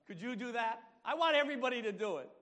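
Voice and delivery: high, loud, quick urging; rousing preacher's shout; fervent exhortation. Real, not acted. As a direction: urge the whole congregation at full voice.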